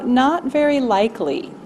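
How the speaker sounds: ordinary talk, nothing marked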